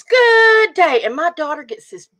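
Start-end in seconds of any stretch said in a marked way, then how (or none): 0.0-1.0 booming radio-ad greeting, higher and louder; radio announcer. Retold or imitated. Mother imitating the store radio ad's booming greeting. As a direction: ham up the commercial voice. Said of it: "the guy goes".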